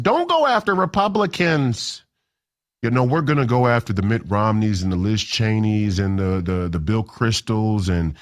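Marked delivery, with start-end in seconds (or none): 0.0-2.0 much higher, whiny boomer imitation; mock boomer. Host mocking boomers who quote Reagan, high whiny mock voice. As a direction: mimic an old boomer quoting Reagan. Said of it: "boomers I still hear quoting".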